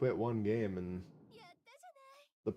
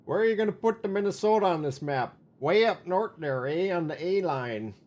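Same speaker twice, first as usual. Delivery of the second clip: put-on Minnesota accent; 7 semitones higher, 5 dB louder than usual. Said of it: "your Minnesota accent sounds vaguely familiar".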